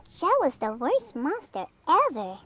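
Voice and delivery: high, loud yipping complaint. Acted. Fifi complaining about Joe, high and loud yip. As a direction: yip it like a little dog. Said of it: "yips Fifi".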